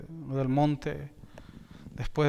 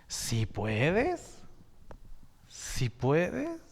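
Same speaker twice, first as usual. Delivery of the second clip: questioning echo; 4 dB quieter, much slower than usual. Retold or imitated. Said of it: "dice el Señor".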